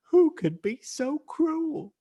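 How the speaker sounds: ordinary talk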